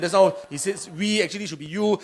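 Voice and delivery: usual speaking voice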